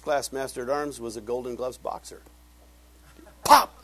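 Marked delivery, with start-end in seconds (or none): 3.4-3.7 sudden loud, high pop; punchy shouted "pop"; punch sound effect. Retold or imitated. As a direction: burst it out like a knockout blow. Imitates the boxer's punch.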